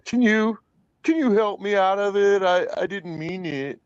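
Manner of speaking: high, whiny mocking plea; whiny crying plea. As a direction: imitate a whimpering begger. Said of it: "he goes crying, saying".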